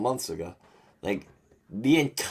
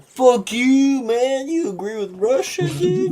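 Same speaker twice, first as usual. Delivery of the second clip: high, loud mock yell of an angry friend; mock outraged friend; 5 dB louder, slower than usual. Friends calling him out over Russia, mock high yell. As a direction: ham up a furious buddy shouting at you.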